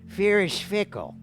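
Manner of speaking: normal talking voice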